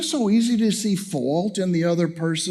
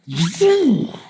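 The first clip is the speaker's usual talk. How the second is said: higher, louder imitated vroom of a fireball; whoosh of fire; 7 semitones higher, 4 dB louder than usual. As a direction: sell the sudden burst of flame. Imitating the grill bursting into flame.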